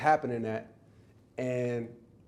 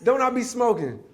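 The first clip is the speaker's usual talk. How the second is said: proud of it; 9 semitones higher, 5 dB louder than usual. Retold or imitated. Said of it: "Like, proud of it".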